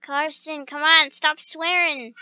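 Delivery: ordinary talk, nothing marked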